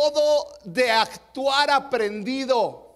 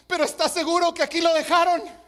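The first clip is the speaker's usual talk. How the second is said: fast, high, loud blurting; frightened drunk; 6 semitones higher, 6 dB louder than usual. Acted. Scared drunk to the pastor as the punchline, mock frightened. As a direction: play a panicked drunk for laughs, high and rushed. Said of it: "todo asustado le dice".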